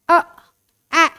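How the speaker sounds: "अ", "ए" as isolated phonics sounds; letter sound. Shown as a demonstration. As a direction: give "अ" then "ए" separately, not the letter name. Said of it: "ए का sound होता है". ("अ", "ए" अलग-अलग ध्वनि के रूप में)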